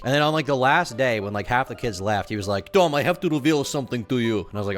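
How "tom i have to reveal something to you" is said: mock Russian accent, slightly raised; hammy Russian-accented confession. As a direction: imitate a kid's put-on Russian accent. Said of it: "he just had a Russian accent".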